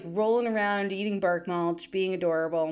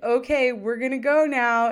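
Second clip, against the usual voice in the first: mom calling time; 4 semitones higher, 7 dB louder than usual. Retold or imitated. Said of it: "the mom's like".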